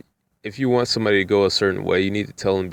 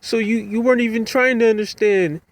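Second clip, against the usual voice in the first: put-on much higher indignant old lady voice; upset old lady; 14 semitones higher than usual. Passenger insisting he judged her accent, high indignant voice. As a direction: squeak out her indignant complaint. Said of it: "She was like".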